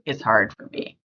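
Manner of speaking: ordinary talk, nothing marked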